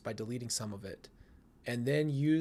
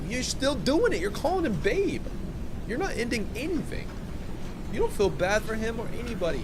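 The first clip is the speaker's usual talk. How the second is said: indignant outrage; 9 semitones higher than usual. Real, not acted. Scolding the woman in the texts, higher indignant outrage. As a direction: call her out, outraged.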